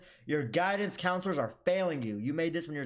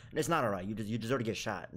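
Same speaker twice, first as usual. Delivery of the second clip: usual speaking voice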